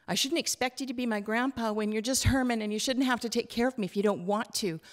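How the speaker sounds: put-on a little girl explaining herself to grandpa; child Hazel, earnest.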